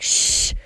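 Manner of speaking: imitated mother's shush; sharp voiceless shush to silence a child; hushing shh. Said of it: "she's like".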